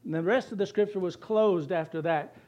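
ordinary talk, nothing marked

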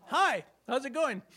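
mock-casual greeting for a laugh. Risen Jesus surprising the women, mildly chirpy hello.

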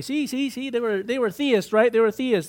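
mocking objector. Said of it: "And you might say".

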